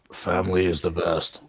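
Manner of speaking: a low, lightly voiced Vin Diesel. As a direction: rumble it like a movie tough guy. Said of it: "Vin Diesel voice".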